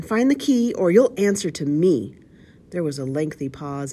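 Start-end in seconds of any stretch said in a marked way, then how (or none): 0.0-2.2 high, loud, menacing demand; shrieked threat. A narrator's reading. Blind man threatens his men over the key, shrieking. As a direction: threaten the gang in a raised shriek. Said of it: "shrieked the blind man".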